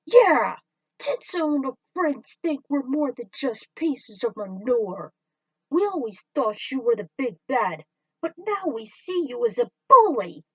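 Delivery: high, loud indignant retort; hurt fans turning on their hero; defiant aliens. Acted. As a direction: stand up to the bully. Aliens defy villain.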